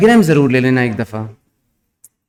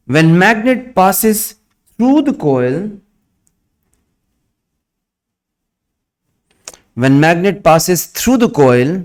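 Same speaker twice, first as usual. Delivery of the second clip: slow, word by word dictation; dictating; much slower than usual. Acted. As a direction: dictate slowly for students to write. Dictation after new line instruction.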